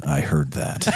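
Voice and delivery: a deep grumpy technician; grumpy tech. Retold or imitated. The grumpy tech snaps at the gambler, low grumble.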